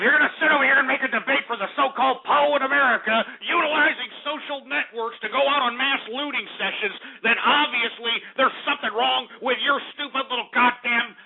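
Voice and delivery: high-pitched, heated rant; pissed off. Real, not acted. Host scolding defenders of the poor, pissed off. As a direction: build up angrily until words fail. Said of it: "I'm just getting pissed off".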